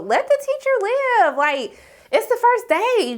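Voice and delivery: mock-exasperated high plea, drawn out at the end. Retold exasperated reaction to Renata.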